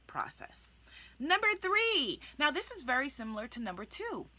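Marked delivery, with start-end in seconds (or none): none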